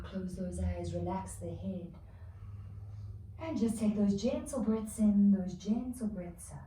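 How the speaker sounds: calming cue that fades to a near hush; guided relaxation. Acted. Relaxation in child's pose.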